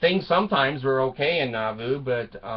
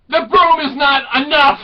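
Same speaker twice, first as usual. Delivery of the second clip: high, loud mock yell; mock outrage; 12 semitones higher, 9 dB louder than usual. Wife done with the broom, hammed-up high shout. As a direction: burst out as the enraged wife. Wife attacks husband after confession.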